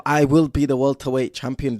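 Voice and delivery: light Liverpool Scouse accent impression; a fan mimicking the Liverpool fighter's Scouse accent; Darren Till Scouse accent. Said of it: "So he talks like this".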